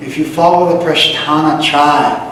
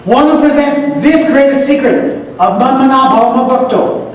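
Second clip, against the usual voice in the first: fervent preaching; 8 semitones higher than usual. Acted. Teacher preaching the Gita's secret to students, fervent. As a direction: preach it with rising conviction. Rising into fervent verse explanation.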